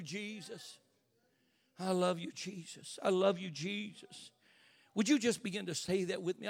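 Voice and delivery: prayer. Acted. Preacher leading the congregation in a soft prayer. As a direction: murmur it to Jesus.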